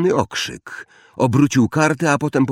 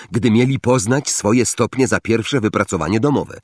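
ordinary delivery, nothing marked

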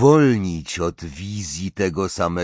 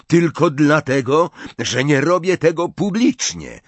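loud, higher defensive outburst; angry protest; 7 semitones higher, 5 dB louder than usual. A narrator's reading. Ron defends himself to mocking Ginny, angry. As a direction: defend yourself hotly. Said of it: "ryknął Ron".

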